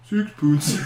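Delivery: deadpan mock-Swedish delivery; Swedish Chef. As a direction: say it dead serious in a silly Swedish accent. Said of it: "looks her dead in the eyes and goes".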